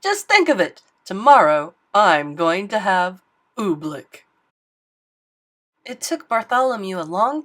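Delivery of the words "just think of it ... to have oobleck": loud, gleeful royal anticipation; king gloating over tomorrow; excited king. Acted. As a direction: savour it like a greedy child-king. Excited king dreams of oobleck.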